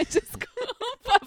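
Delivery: slow, high, laughing sorry; laughing apology. Real, not acted. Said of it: "foi muito longe".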